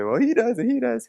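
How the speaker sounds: high-pitched mock sibling protest; imitating squabbling siblings. Teacher mimics brothers and sisters squabbling over control, high mock protest.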